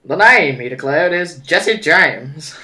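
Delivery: loud, drawn-out cowboy voice; cowboy accent. Acted. Player taking over as Jesse James, hammed-up cowboy accent. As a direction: play a cowboy bandit, stretching the name. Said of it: "You do the cowboy accent".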